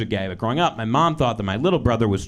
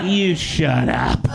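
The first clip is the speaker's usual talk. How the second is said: slow mock mom, less high; calmer repeat; 3 semitones higher, 4 dB louder, much slower than usual. Mom's retort replayed slow, a notch calmer. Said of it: "One glass of Pinot Grigio".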